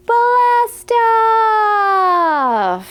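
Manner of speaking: big playful launch cheer; excited blast off. Acted. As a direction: shout it like the rocket takes off. Rocket countdown ends.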